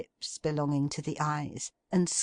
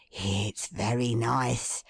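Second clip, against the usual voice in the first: slow, low musing; musing Toby; 5 semitones lower, slower than usual. A narrator's reading. Toby muses over the smell, slow and low. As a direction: savour it thoughtfully.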